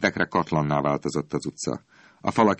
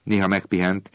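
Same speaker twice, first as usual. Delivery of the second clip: ordinary delivery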